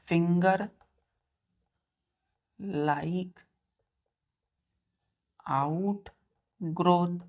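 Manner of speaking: drawn-out, word by word; slow dictation. Shown as a demonstration. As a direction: let each word hang so the students can copy it. Dictating key term slowly.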